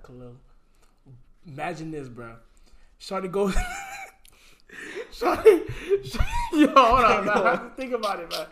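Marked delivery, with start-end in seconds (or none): 3.6-8.5 high, loud laughing through the words; can't stop cracking up; real laughter fighting to speak. Real, not acted. Laughing before telling story.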